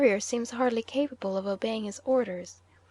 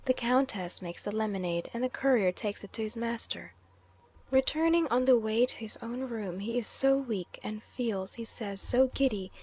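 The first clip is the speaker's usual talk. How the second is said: ordinary delivery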